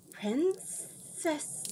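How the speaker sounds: high, hissing, distant voice; creeping nightmare whisper. A narrator's reading. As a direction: hiss it from under a rock. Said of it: "hissed the voice from under the rock".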